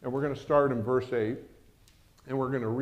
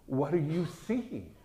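incredulous servant; 3 semitones higher, 5 dB quieter, slower than usual. Acted. Servant doubting the prophet.

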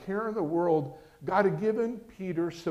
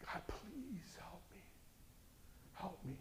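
begging for mercy; 26 dB quieter than usual. Retold or imitated. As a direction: whisper a broken plea to God. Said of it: "begging for mercy".

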